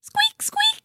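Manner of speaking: very high tiny squeaks; little mouse squeak. A narrator's reading. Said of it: "said a little mouse".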